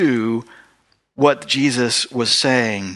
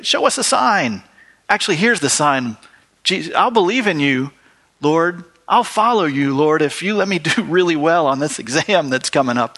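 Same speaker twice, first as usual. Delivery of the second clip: higher, quick pleading of a selfish follower; mock bargaining crowd; 4 semitones higher than usual. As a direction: plead like someone cutting a deal with God. Crowd bargaining with Jesus, mocked.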